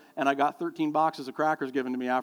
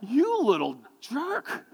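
slow, laughing you little jerk; mock-scold with a laugh; laughing mock insult; 9 semitones higher, 3 dB louder, much slower than usual. Father after the cracker punch, slow laughing insult.

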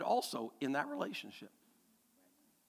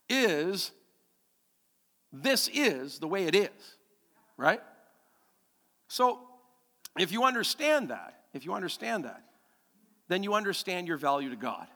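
ordinary talk

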